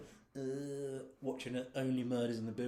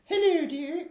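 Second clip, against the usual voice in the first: put-on squeaky old lady voice, much higher and louder; too-high old lady; 8 dB louder, slower than usual. Man overshooting his old lady impression, far too high. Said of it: "No, it's too high".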